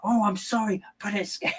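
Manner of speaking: high-pitched mock apology; imitated scared friend. Mimicking a scared friend apologising for swearing, high mock-frightened voice. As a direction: squeak out a hammed-up excuse, far above your own voice. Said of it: "She's like".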